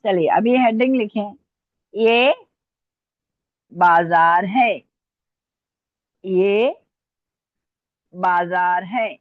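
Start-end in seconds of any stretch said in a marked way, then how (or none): 1.9-9.1 word by word, long vowels and pauses; slow dictation. Real, not acted. Teacher dictating a heading to students, slow dictation. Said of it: "मैं dictate करूं".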